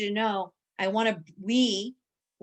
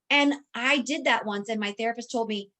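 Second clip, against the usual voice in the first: normal talking voice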